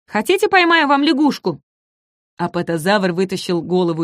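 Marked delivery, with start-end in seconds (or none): 0.1-1.6 high, pleading offer; eager little dinosaur. Acted. Ком зазывает апатозавра, звонко. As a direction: try hard to please.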